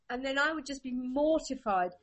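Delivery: ordinary talk, nothing marked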